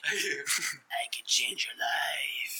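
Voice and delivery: breathy, very slow whisper; slow mystic whisper. Pretend. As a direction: whisper it like a shady guru. Worm as voodoo guru.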